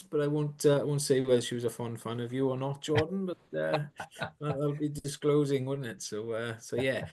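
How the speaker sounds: hushed, teasing side remark; quiet aside. Real, not acted. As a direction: drop the voice for a cheeky aside. Teasing aside about his wife.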